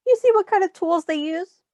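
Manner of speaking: ordinary talk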